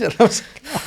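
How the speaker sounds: ordinary delivery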